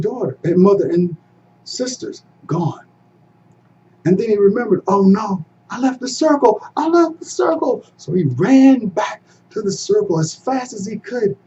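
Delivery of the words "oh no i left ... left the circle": dismay. A narrator's reading. Realizes mistake.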